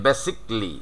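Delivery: "basically" in three syllables, BASIC-lee; "basically" as basik-li, falling at the end; correct "basically". Shown as a demonstration. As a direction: say "basically" with the middle "ca" dropped. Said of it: "আমাদের উচ্চারণ দাঁড়াচ্ছে এরকম".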